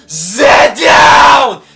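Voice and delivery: sudden high shouted order, slow and drawn out; barking shout. Retold or imitated. Rude clerk barking at a customer, shouted order. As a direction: bark it like a furious clerk. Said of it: "She's like".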